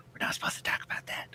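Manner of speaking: hushed mock whisper. Imitating cohost's hushed whisper about forbidden topics. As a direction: whisper it like a secret. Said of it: "He's like".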